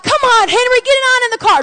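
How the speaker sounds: high, loud retold summons; calling him over. Retold calling Henry into car.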